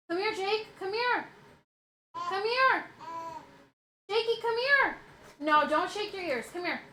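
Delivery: high sing-song call to the dog. Real, not acted. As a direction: coax the dog over. Calling dog repeatedly.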